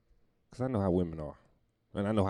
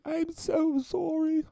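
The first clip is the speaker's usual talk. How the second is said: much higher, slow, prim English woman's apology; a dainty Mary Poppins sorry; prim English lady; 4 dB louder, much slower than usual. Retold or imitated.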